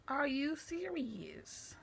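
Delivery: incredulous. Retold or imitated. Disbelieving reaction to fight.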